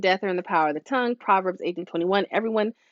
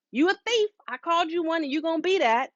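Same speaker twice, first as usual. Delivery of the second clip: higher mock accusing parent; mock accusation; 8 semitones higher, 3 dB quieter, faster than usual. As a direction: toss out the label carelessly. Mock labeling a child.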